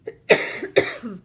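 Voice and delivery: breathy tickly cough; dry cough. Real, not acted. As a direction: cough away from the mic. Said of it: "a little tickle in my throat".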